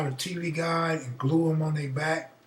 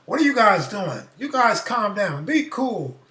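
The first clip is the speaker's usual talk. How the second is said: parent scolding; 5 semitones higher, 5 dB louder than usual. Retold or imitated. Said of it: "The parents be like".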